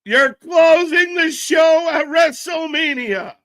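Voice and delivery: very high, slow, drawn-out shout; excited shout. Real, not acted. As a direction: shout the big news way up high. Excited reaction to live wrestling news.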